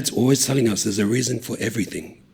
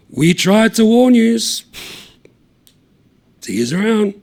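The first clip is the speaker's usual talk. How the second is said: taunting warning; 12 semitones higher, 6 dB louder than usual. Acted. Taunting the pub crowd.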